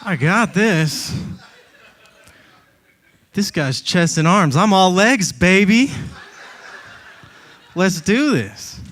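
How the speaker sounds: smug like a fighter sizing you up; lightly voiced cocky pit bull; cocky dog voice. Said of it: "the dog's gonna ... be like".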